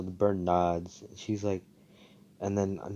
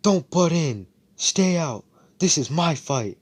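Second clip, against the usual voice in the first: higher, louder defiant yell; mock angry shout; 9 semitones higher, 6 dB louder than usual. Luck telling Asta to stay out of his fight, defiant shout. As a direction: warn him off, hotly. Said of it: "stops and turns around".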